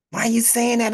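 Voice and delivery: high-pitched elder telling off a rude youth; loud, high rebuke from an elder; scolding elder. Retold or imitated. Elder on the bus rebuking cursing youth, high and loud.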